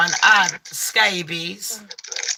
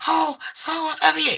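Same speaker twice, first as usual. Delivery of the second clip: professional cheer; 6 semitones higher than usual. Retold or imitated. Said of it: "had to be all professional".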